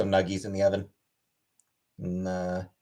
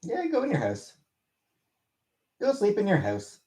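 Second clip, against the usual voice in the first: pet talk voice; 9 semitones higher, 3 dB quieter than usual. Real, not acted. Talking to cat, high voice.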